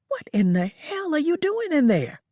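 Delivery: irritated. A narrator's reading. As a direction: demand an answer. Lola annoyed at Georgia.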